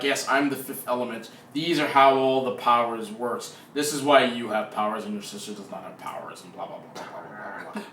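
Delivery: low mystical exposition voice, sinking away; fifth element's voice. Acted. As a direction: intone it low like an all-knowing being, fading into soft mumbled blahs. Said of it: "I don't know why I'm talking in that voice".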